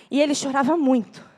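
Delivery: normal talking voice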